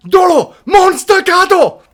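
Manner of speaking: high, very loud battle cry; imitated anime scream. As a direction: scream it like a Japanese anime duellist. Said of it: "it's just the guy and he screams".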